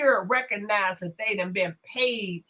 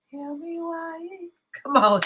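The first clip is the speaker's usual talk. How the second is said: playful plea; 5 semitones higher than usual. Pretend. Drawn-out plea to the chat.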